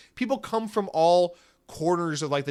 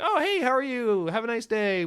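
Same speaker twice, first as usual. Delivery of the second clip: polite reply; 9 semitones higher, 3 dB louder than usual. Retold or imitated. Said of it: "wave pleasantries".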